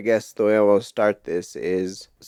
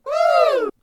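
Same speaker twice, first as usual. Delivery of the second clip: high cheering whoop; excited whoop; 4 dB louder than usual. Real, not acted. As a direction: whoop for the guest. Host cheers welcoming his wife.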